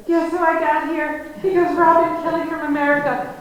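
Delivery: high, loud imitation of a proud farmer; proud announcing. Old farmer presenting her to the postmistress, high and loud. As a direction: show her off like a prize at the counter.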